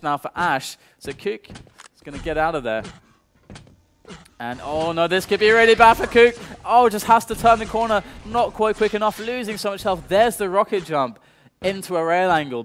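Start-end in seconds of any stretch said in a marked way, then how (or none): 4.4-12.6 rising, louder play-by-play call; excited esports caster. Acted. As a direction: call the fight as it explodes.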